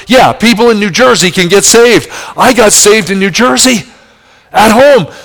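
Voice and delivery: preacher proclaiming salvation in New Jersey; exultant preaching. Acted. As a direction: shout the good news.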